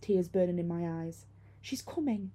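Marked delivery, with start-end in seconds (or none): 1.6-2.3 tight, higher, terrified warning; true terror. A narrator's reading. Girl convinces her friend it's real, terrified. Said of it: "a tightness that only true terror could cause".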